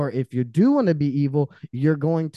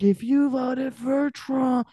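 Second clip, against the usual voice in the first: high, very slow, preachy put-on voice; mocking her younger liberal self; mocking old self; 9 semitones higher, much slower than usual. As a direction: drag it out like a smug liberal.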